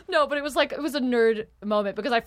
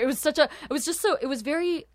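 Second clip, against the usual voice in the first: normal talking voice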